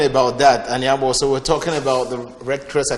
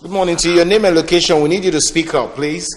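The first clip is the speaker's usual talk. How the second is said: to someone hard of hearing; 5 semitones higher, 5 dB louder than usual. Real, not acted. Caller on phone cannot hear.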